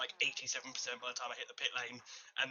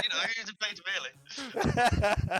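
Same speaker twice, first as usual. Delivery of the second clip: laughing; 6 semitones higher, 8 dB louder, much slower than usual. Real, not acted. Joking about the traitor.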